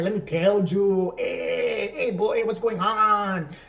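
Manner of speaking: broad, drawn-out fake Mexican accent; mock a husband's slow, loud faux-Spanish voice; horrible bad accent. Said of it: "does a horrible bad accent".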